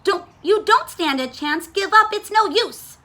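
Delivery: storybook quarrel, voice climbing; hollered warning. A narrator's reading. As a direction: holler at your rival to back off. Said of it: "hollered Bruce".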